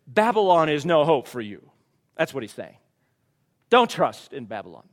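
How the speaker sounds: fired-up preacher; preaching at full force. Acted. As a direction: drive it home to the congregation.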